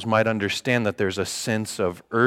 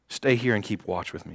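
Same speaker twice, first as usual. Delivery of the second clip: quiet repeat; 3 semitones lower, 9 dB quieter than usual. Shown as a demonstration. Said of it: "You see that last line".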